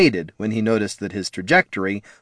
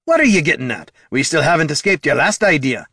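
higher, exasperated dwarf; 6 semitones higher, 3 dB louder than usual. A narrator's reading. Dwarf doubting the bard's next idea, exasperated. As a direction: challenge him impatiently.

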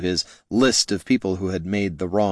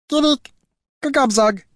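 very high, louder goblin chatter; 4 dB louder than usual. Acted. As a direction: reassure him in goblin gibberish. Goblin reassures in goblin.

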